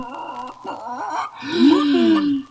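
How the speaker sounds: sharp astonished gasp; a quick gasp of shock. A narrator's reading. Narrator gasps as the chicken changes, astonished.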